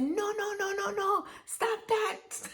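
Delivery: high-pitched mock grandmotherly scolding. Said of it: "my grandmother said".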